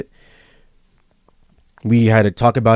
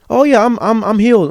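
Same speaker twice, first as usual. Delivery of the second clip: high-pitched overconfident claim; put-on breezy confidence; breezy mock assurance; 12 semitones higher, 3 dB louder than usual. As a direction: toss it out high, like someone too sure of themselves. Mocks claiming to be healed.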